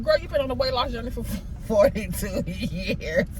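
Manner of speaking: chuckling through a scolding of herself; laughing at herself while she scolds; laughing self-scold. Acted. Teasing herself about an endless diet, laughing.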